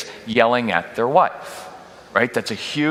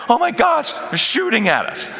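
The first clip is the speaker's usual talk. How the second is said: panicked; 10 semitones higher than usual. Acted. Panicking captain in battle.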